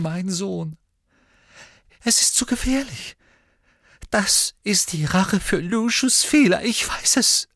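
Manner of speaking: high, tearful, pleading voice; weeping. A narrator's reading. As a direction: start breathy, build to an anguished high outburst. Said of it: "Narzissa begann heftig zu weinen".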